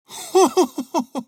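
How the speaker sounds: loud high guffaw; a fawning courtier laughing; burst of laughter. Acted. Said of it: "Разразился смехом Руф Билан". (громкий хохот)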